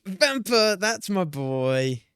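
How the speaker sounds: high, loud name, then drawn-out praise; proud, slow-rolling celebration; excited cheer. Real, not acted. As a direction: cheer on your favourite player, stretching the words. Cheers a player who guessed right.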